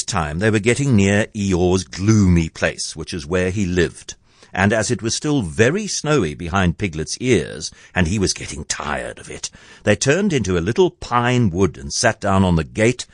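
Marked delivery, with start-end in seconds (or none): none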